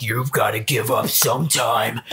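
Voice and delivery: a hint of a taunting old dragon, pitched low. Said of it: "And he's like".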